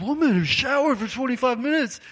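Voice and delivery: high, loud indignant mock outburst; mock outrage. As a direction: burst out in playful outrage. Group recalling the long shower.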